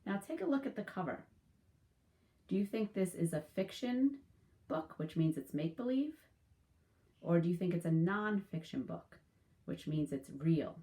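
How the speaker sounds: usual speaking voice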